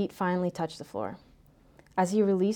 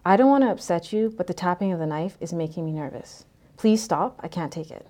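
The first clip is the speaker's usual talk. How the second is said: ordinary delivery, nothing marked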